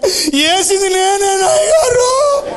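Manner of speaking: very high, slow sobbing wail; crying confession. A narrator's reading. Boy confesses to pastor, wailing. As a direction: blurt the confession through tears. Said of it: "ఏడుతున్నాడు".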